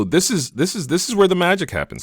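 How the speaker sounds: building hype before showing the result; excited reveal. Real, not acted. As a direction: pitch jumps way up on the magic line.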